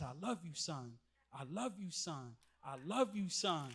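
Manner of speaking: hushed, tender and falling; barely above a breath, fatherly; quiet loving repetition. Retold or imitated. Pastor hugging him, retold words.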